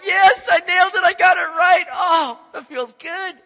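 high, loud gloating joy; elated Peter. Retold or imitated. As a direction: crow over getting it right. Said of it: "doing cartwheels all through Caesarea Philippi".

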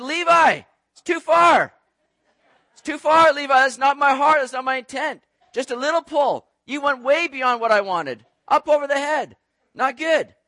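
loud, high-pitched dad protest; mock scolding. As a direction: exclaim in exaggerated dismay at the kid. Dad scolding son for going too far.

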